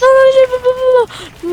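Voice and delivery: panicked. Real, not acted. Panic in game.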